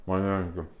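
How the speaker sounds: chewing while talking; mouth full. Real, not acted. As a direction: speak through a mouthful. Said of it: "ho la bocca piena".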